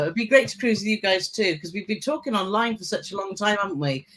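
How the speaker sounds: normal talking voice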